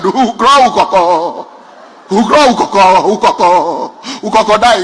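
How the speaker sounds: chanted echo of a stammer; class mimicking stammerer. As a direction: repeat the stammer like an obedient class. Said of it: "The whole class shouted".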